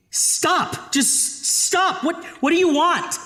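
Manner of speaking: high whispered panicked pleas; terrified scream. Acted. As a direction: plead in terror for it to stop. Terrified pleading with the voice.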